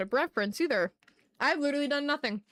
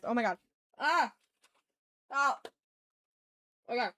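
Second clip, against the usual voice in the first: ordinary talk, nothing marked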